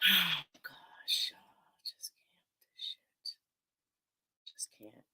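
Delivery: sigh, then whisper it, slow and worn out; exasperated whisper. Real, not acted. Streamer giving up on a clip, drained slow whisper. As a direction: breathe out the words like you're done.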